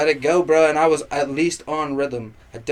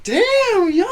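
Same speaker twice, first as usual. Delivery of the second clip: much higher, awed crowd voice; impressed crowd; 3 dB louder than usual. Retold or imitated. Crowd of girls wowed by his bars, much higher. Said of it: "everybody's like".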